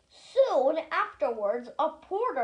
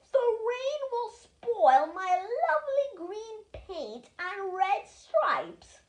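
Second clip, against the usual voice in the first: fussy Henry; 7 semitones higher, slower than usual. A narrator's reading. Henry afraid for paint.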